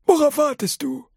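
put-on high old man, brushing it off; old grandpa voice. A narrator's reading. Grandpa Joe tells Charlie to forget the tickets, high old voice. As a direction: wave it away in a high grandpa voice.

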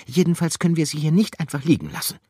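high, loud, fast, firm; Gruyère answers decisively. Acted. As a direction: state it with determination. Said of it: "antwortete Gruyère entschieden".

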